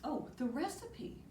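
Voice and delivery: ordinary delivery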